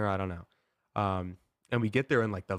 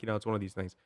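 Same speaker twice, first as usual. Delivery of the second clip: ordinary talk, nothing marked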